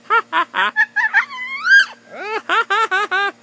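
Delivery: big shouted laugh; loud laughing burst. Real, not acted. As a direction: let out a loud high cackle.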